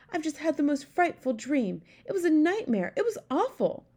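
high-pitched, upset royal lady; distressed queen. A narrator's reading. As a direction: recount a nightmare as a shaken queen. Queen recounts her nightmare.

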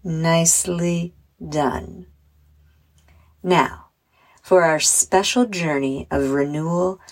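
measured meditation voice with long pauses; unhurried guided relaxation, phrase by phrase. Acted. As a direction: guide children gently through breathing and imagery. Leads a guided visualization.

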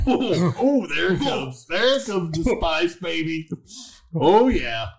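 loud excited yelps as heat hits; whooping as the burn arrives; spice kicking in. Real, not acted. Spice hits after eating bean.

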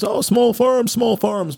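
high mocking singsong. Mocking what everybody says.

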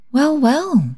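high, swooping fond exclamation; fond cooing. A narrator's reading. Susan touching the baby's head, fond cooing. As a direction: coo it high over the baby. Woman coos over the baby.

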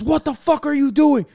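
high angry shout, retold; furious officer yell. Customs officer blowing a gasket at the traveler, high furious yell. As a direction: bark it like an officer losing his temper. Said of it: "blows a gasket".

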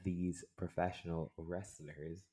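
slow, deliberate enunciation; careful retry. Real, not acted. Retrying a tongue-tied phrase for a teasing friend, slow and careful.

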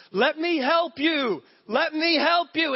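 slow, high, loud shout; a father chasing after his son; pleading shouted offer of help; calling dad. Retold or imitated. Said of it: "the dad chases after him going".

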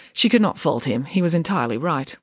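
usual speaking voice

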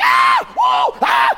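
hammed-up high shrieks of alarm; comic falsetto screaming at the screen; mock freak-out yelps; high panicked movie-watcher screams. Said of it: "I'm acting crazy".

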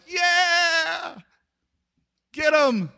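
high, stretched-out, held-back cheer; guilty cheer. Retold or imitated. Townsfolk cheering against their related southern brothers, hesitant. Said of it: "not supposed to say they don't like 'em".

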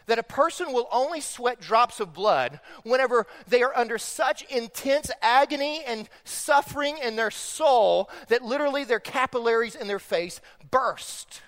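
ordinary delivery

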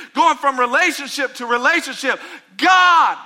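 desperate plea. Acted. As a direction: beg God to take the pain away. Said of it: "You're like".

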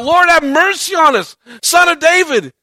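crying out. Acted. Disciples crying out to Jesus for mercy, loud plea. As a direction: cry out to him, begging. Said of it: "the one crying out".

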